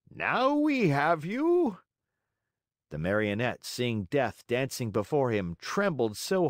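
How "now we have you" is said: slow, high, loud menacing villain; growled threateningly. Acted. Assassins seize Pinocchio by the neck, slow menacing snarl. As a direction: savour the catch, drawn out. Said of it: "growled threateningly".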